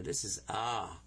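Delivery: ordinary talk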